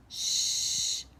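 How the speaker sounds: loud unvoiced shush; hushing shh. Storyteller hushing the children. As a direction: hush the room before the story.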